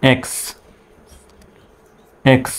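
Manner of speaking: "এক্স" said softly and short as one clipped syllable; model sound. Shown as a demonstration. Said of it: "এক্স এর উচ্চারণটা হবে". (নরম করে ছোট্ট উচ্চারণ "এক্স")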